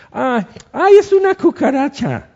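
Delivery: high, loud panicky squeal at a cockroach; shrieking in mock terror; mock shriek of fright. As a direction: ham up a person terrified of a bug. Mocking someone scared of cockroach.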